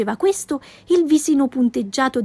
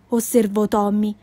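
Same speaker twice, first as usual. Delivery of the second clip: plain reading voice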